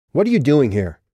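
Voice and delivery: hard, pressing question; Lauren asks again, voice gone hard; hard demand. Acted. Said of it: "her voice gone hard".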